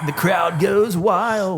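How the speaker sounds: high, drawn-out fake crowd cheer; mock crowd announcer. Host hyping his guest's entrance, mock crowd cheer. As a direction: stretch it out high like a stadium hype man.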